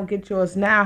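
usual speaking voice

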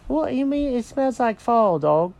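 high-pitched cartoon cat, questioning; high, baffled character voice; puzzled cat. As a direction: ask the dog in a high cat voice. Jenkins questions the dog.

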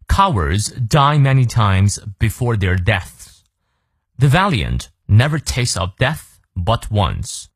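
slow, measured English recitation; slow model reading. Shown as a demonstration. Teacher presents Caesar's quote to learners, slow model reading. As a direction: read it out slowly, phrase by phrase.

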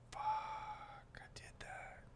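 hushed, barely voiced self-talk; stunned whisper. Man to himself after breaking a window, stunned whisper.

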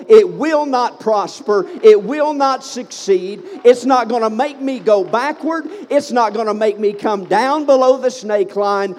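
loud, rising declaration of faith; full-force preaching. Real, not acted. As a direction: preach it hard, hammering each promise.

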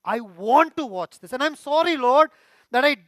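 loud, high, anguished plea to God; pleading confession. Shown as a demonstration. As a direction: pour it out as a desperate, earnest prayer. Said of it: "That is true confession".

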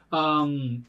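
ordinary delivery

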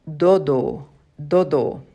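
dodo said clearly for repeating; Spanish word. Shown as a demonstration. Teacher modelling dodo for students. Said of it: "A kind of bird".

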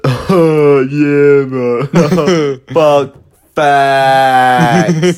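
loud, high dopey bro; dumb bro voice. Retold or imitated. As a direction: play the clueless podcast bro. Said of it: "one guy is just like".

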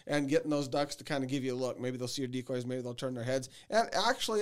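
ordinary delivery

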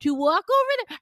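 high-pitched mock-bossy order; bossy dismissal. Teasing a brother-like friend at tequila tasting, bossy. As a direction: send him off with comic insistence.

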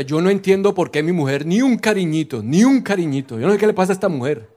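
mock baffled husband complaining to the pastor; whiny husband. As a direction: sulk about getting no affection.

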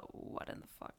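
breathy whispered aside. Retold or imitated. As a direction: whisper it as a baffled listener. Imagined thought of Japanese listeners.

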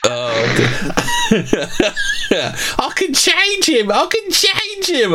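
high, slow lovestruck woman; swooning woman voice. Pretend. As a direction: gush it like a smitten girl. Mocking a smitten woman.

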